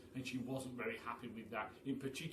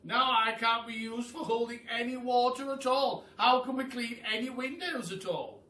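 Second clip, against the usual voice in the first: higher, loud dismay; glum pelican; 9 semitones higher, 9 dB louder than usual. A narrator's reading. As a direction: sigh it out like a dejected pelican. Pelican voiced in the story.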